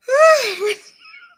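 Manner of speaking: loud, high-pitched exhaling cry; high venting sigh. Real, not acted. Host trying to calm down after ranting, high venting sigh. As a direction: let out a high, sharp sigh.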